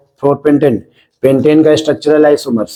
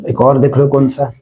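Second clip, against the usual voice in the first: normal talking voice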